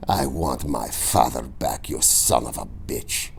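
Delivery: low, soft deadly hatred; cold vow. A narrator's reading. Inigo refusing the Count's bribe, low and quiet.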